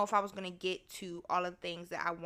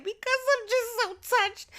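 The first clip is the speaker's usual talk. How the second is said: very high, hammed-up weepy squeal; mock tearful; 4 dB louder than usual. Speaker answering why she cries, mock tearful. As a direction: squeal it as if overcome with emotion. Acts out crying reply.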